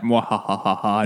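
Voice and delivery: slow evil mwahaha laugh; cartoon villain cackle. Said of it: "He was cartoonishly evil".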